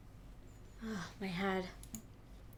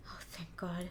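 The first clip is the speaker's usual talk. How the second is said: whispered thanks, all air, no voice; a whispered sigh of relief; relieved whisper; 5 semitones lower, 9 dB quieter than usual. Acted. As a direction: breathe it out in a whisper, softer. Relief that friend is stable.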